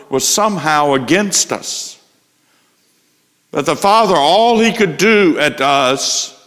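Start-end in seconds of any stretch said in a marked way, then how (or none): 3.5-6.4 mock wrath. Mocking view of angry God.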